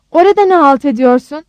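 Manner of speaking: loud, furious shout; full-force yell. A narrator's reading. Rose shouts at her daughter on the phone, yelling. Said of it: "telefona haykırdı var gücüyle".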